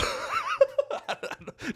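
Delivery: a high breathy giggle at his own idea. Real, not acted. Streamer giggles at his Bible workout channel idea, high. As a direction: crack up at the joke.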